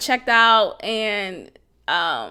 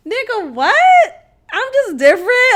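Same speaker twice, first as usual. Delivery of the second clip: incredulous; 10 semitones higher, 8 dB louder than usual. Real, not acted. Scoffing at the line men use, high and loud. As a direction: react like it's absurd. Outraged reaction to cliché.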